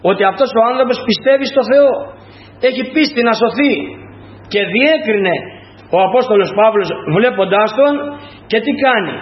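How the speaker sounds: normal talking voice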